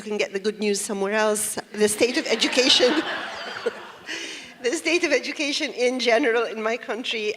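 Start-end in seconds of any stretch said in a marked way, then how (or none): 3.1-4.6 wry laugh. Real, not acted. Wry laugh about education.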